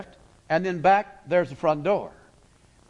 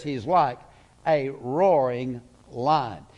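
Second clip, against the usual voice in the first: slow emphasis; 4 semitones lower, much slower than usual. Acted. Preacher slowing for the image.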